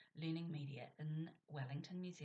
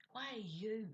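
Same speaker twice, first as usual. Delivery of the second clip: "you" drawn out and pushed louder; higher question aimed at "you"; emphasis on "you"; 5 semitones higher, 4 dB louder than usual. Shown as a demonstration. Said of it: "placed the emphasis on you".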